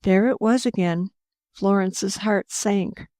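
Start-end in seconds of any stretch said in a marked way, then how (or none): none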